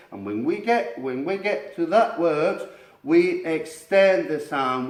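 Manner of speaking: put-on slow, careful English with a Spanish accent. Teacher coaching students in Spanish-accented English. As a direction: explain it deliberately in accented English.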